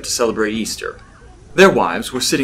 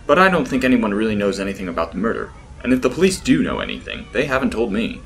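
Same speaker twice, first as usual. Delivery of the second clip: ordinary delivery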